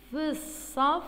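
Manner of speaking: في الصف run together with a held s; prosodic sounding-out. Shown as a demonstration. Teacher showing how it is written, في الصف sounded out. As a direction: stretch في الصف into two joined-up pieces. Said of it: "الصف، كيف نكتبها؟".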